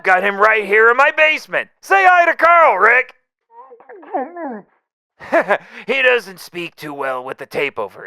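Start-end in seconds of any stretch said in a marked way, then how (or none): 1.8-3.1 calling out. Acted. Calls to captive in basement. 4.1-4.6 villainous chuckle. Acted. After taunting about hostage.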